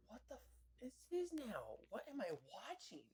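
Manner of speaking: very high, strained voice of horror; shocked squeal. Retold or imitated. Viewer reacting to the monster, very high and shocked. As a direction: squeak out your disbelief.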